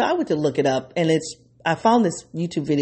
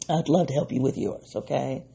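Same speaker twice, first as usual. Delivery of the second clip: usual speaking voice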